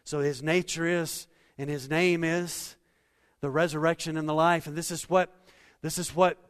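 usual speaking voice

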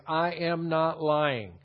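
slow, measured, each word given room; deliberately slow. Shown as a demonstration. Preacher quoting Paul's oath to the congregation, slow and emphatic. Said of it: "that's why I said it the way I did".

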